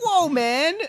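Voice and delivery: high, startled "whoa"; hammed-up shocked exclamation; mock shocked. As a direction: recoil with a mock-offended cry. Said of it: "and he's like".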